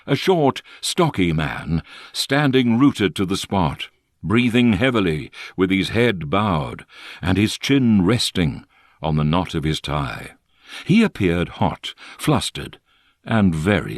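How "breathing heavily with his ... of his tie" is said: plain reading voice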